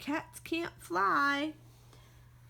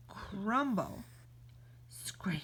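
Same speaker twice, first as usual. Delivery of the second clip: ordinary delivery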